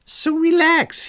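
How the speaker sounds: high, smug "relax"; a touch of villainous ease; sly villain. A narrator's reading. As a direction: toss it off smugly, high. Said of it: "he said".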